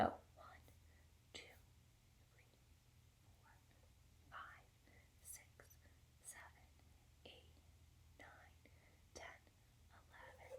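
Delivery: whispered count, barely audible. Shown as a demonstration. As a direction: count the dots in a hush. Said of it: "we're gonna whisper count".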